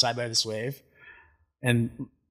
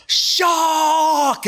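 long, high screamed warning in imitated accent; loud drawn-out imitation of a panicked shout; Rosie; 7 dB louder than usual. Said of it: "in her accent".

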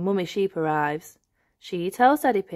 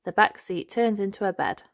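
ordinary delivery, nothing marked

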